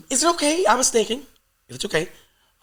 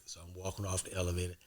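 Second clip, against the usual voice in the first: ordinary talk, nothing marked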